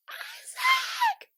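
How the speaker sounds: very high, slow, shouted name; drawn-out squealing call of "Isaac"; excited shout. Real, not acted. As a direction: yell his name with joy. Excited calling rookie's name.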